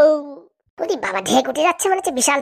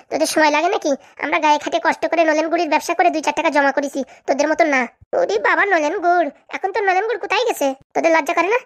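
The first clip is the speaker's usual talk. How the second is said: higher-pitched bickering women's voices trading insults; quarrelling village wives; 3 semitones higher than usual. Acted. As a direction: play two sharp-tongued wives in a spat.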